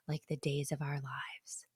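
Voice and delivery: dramatic hushed whisper. Real, not acted. Wife whispers an idea for a soap-opera intro. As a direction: breathe it like a secret. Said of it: "whispered aside".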